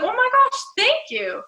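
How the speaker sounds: gushing flattered thanks; flattered squeal. Retold or imitated. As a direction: squeal it like someone just complimented your hair. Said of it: "you'd be like".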